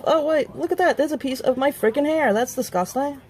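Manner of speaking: high, startled grossed-out voice; surprised disgust. Real, not acted. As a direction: react to finding hair. Finds hair in product.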